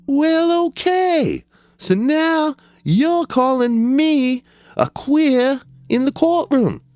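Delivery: a whiny defendant talking back to the judge; high mock voice of a defendant complaining to the judge.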